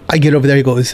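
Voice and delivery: ordinary talk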